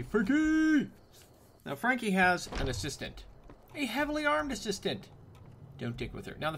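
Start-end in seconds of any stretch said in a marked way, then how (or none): 0.0-0.9 high, loud playful holler; calling out. Pretend. Gamer calling to the shopkeeper, high loud holler.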